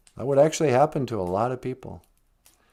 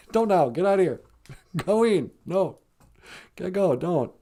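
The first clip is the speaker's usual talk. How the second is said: a hint of a grandma scolding a child, pitched up; 8 semitones higher than usual. Buddy imitating his grandma scolding him as a kid. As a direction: shoo the kid off in a mild mock scold. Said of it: "she was like".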